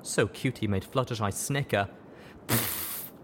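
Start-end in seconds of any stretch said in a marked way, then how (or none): 2.5-3.1 breathy scoffing pfft; dismissive pfft. A narrator's reading. Rush scoffing at her pestering little brother. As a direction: blow the kid off with a scoff. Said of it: "Rush said, blowing her sibling off".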